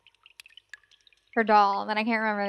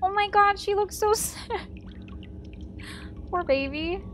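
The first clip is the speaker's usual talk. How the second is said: pitying coo; 8 semitones higher than usual. Real, not acted. Pitying a sad character.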